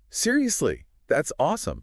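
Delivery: high, excited approval; enthusiastic. Shown as a demonstration. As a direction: cheer the news on. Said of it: "an enthusiastic".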